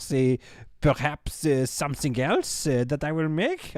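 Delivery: put-on French accent. Streamer describing what to build next, fake French accent. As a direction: slip from French into French-accented English. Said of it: "I started doing an accent".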